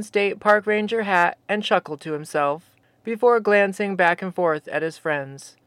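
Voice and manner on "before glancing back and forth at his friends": ordinary delivery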